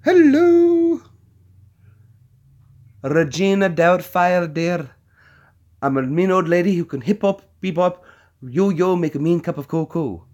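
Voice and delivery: put-on high-pitched old lady character voice; hammed-up high old-lady impression; Robin Williams. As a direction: trill it as a mean old lady, loud opening hello. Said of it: "Like, like Robin Williams".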